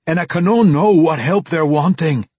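loud, high, distraught dwarf voice; agitated dwarf. A narrator's reading. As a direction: cry it out as a bewildered dwarf. Terrified dwarf speaks in story.

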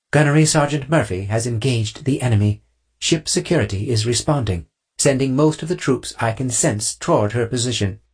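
measured tactical update in a put-on voice; Pandora. Pandora breaks the silence to update the squad, crisp report.

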